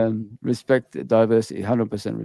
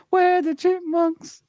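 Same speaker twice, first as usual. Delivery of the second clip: sped-up, squeaky chipmunk voice; helium-high glitchy audio; much slower than usual. Real, not acted. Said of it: "He sounds like a chipmunk".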